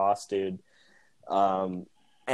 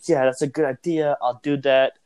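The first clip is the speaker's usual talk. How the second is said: put-on Lebanese accent; 3 semitones higher, 4 dB louder than usual. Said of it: "in his weird, thick Lebanese accent".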